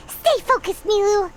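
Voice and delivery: very high-pitched, urgent cheer; urgent encouragement. Acted. Supporter telling Nilu to stay focused, urgent. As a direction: urge her on from the sidelines.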